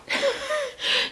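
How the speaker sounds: high-pitched burst of laughter. Real, not acted. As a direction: crack up, squeaky and high. Laughing at her own retelling.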